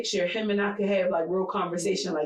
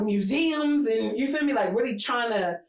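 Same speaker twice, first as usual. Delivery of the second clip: ordinary talk, nothing marked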